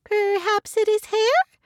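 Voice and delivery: very high, slow mouse voice; squeaky little mouse guessing; unsure mouse. Acted. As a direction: offer a guess in a tiny mouse voice. Mouse answers with a shrug.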